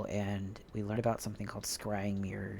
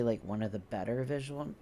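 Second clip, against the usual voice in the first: usual speaking voice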